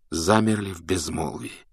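ordinary delivery

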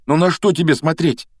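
high, loud protest; indignant. Acted. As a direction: argue back indignantly. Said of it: "возмутился Гарри".

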